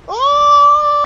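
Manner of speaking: high, loud "oh"; startled cry. Real, not acted. Player yelps at the game, startled cry. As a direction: cry out in sudden alarm.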